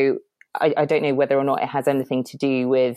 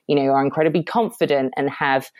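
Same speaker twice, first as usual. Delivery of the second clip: normal talking voice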